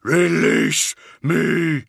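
high, loud, drawn-out creature roar; thundering monster. A narrator's reading. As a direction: thunder it slowly as a huge otherworldly being. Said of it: "the being thundered".

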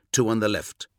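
ordinary delivery, nothing marked

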